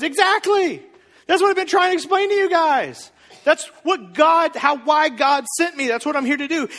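high, loud, emphatic exclamation; finally being understood, voice pitched right up; Jesus. Acted. Answering the religious people, acted exasperated excitement.

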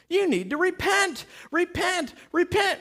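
high-pitched, insistent preaching cry; preacher's call to repent. Acted. John preaching to temple-goers, calling them to repent.

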